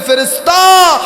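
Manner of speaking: loud, high summoning cry; calling out. Acted. God summons an angel in the preacher's story, calling out. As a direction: call it out with full force. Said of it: "আল্লাহ ডাক দিয়ে বলে".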